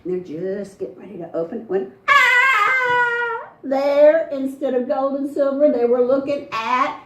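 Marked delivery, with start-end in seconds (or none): 2.1-3.5 loud, much higher shriek of fright; startled scream. Acted. Boys find Dry Fry in the sack, screaming. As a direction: scream as the body appears.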